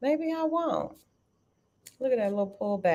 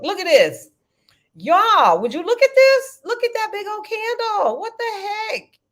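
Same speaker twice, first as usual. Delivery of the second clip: high and loud, thrilled by the jump; excited shout at the chart; 12 semitones higher, 5 dB louder than usual. Real, not acted. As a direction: call out to the chat in delight. Stock chart spikes during live trading.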